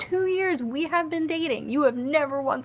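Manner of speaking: high-pitched retold scolding; mock indignation. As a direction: scold your boyfriend in hammed-up indignation. Acted quarrel with boyfriend.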